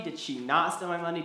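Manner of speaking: "not" punched out loud; stress on NOT. Shown as a demonstration. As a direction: hit "not" hard in the question. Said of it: "it changes of who's accusing who".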